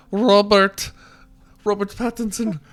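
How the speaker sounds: a half-baked German accent, hammed up; bad German accent. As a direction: fumble through a German accent, like Uwe Boll calling. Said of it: "I have no idea what a German accent is".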